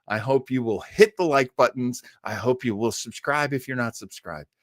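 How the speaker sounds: ordinary talk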